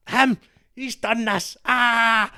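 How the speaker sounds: high, loud wail of accusation; hammed-up tattling ending in a scream; hysterical mock outburst. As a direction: wail it, pitch far above normal. Acted outburst to guidance teacher.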